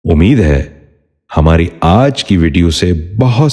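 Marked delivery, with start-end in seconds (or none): none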